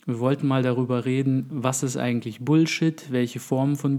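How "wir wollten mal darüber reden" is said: ordinary talk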